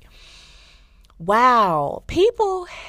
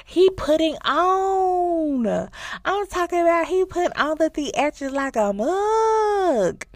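excited; 10 semitones higher, slower than usual. Real, not acted. Excited recap of the scene.

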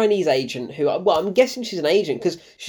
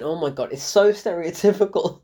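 ordinary talk

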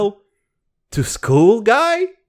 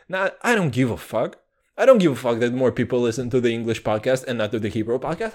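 ordinary talk

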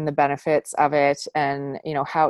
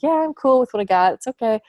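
a higher, breezy shrug of a voice; mock complacent; 7 semitones higher, 4 dB louder, faster than usual. Host mocking settling for less, breezy higher shrug. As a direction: brush it off like nothing matters. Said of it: "just being like".

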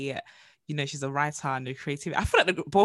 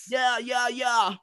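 high laid-back yeah yeah; stoner voice; 7 semitones higher, 3 dB louder, much slower than usual. Acted. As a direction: stay in the dude voice. Continues stoner bit.

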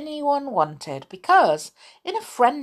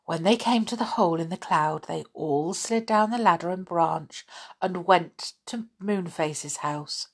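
ordinary delivery

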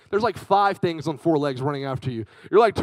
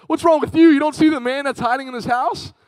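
high, loud mock hysteria; hammed-up terrified kid shouting at dad; frantic retort; 10 semitones higher, 6 dB louder than usual. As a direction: throw it back in a panicked shriek. Frantic child answers dad back.

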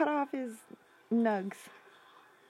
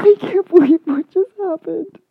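loud, high disbelief; shocked outburst; 5 semitones higher, 17 dB louder than usual. Real, not acted. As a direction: blurt it out, stunned. Reacting to shocking game event.

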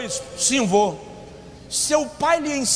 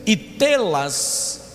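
preaching at full force; 4 semitones higher, much slower than usual. Acted. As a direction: proclaim the verse, stretching every word. Reads scripture at full force.

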